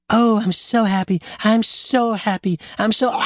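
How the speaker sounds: high, gushing happiness; overjoyed mock swoon. Acting out the father dying of joy, overjoyed gushing. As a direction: gush in a high, overcome voice.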